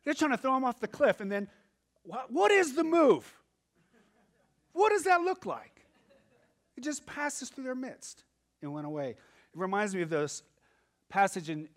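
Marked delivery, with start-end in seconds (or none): 2.0-5.9 high, loud wondering; amazed question. Real, not acted. Preacher marvelling to the congregation, high and amazed. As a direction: ask it in astonishment.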